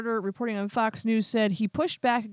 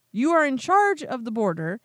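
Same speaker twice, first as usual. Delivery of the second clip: usual speaking voice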